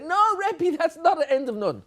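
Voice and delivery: high-pitched pupils' protest; children correcting teacher. Pretend. Children telling Rebbe he chose the wrong nun, high protest. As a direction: object like kids catching the Rebbe out. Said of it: "And the children say".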